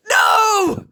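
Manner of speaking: high, loud cry of horror; anguished cry. A narrator's reading. Scarlet seeing Perry bitten, high anguished shout. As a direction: scream it in despair. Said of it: "Scarlet cried".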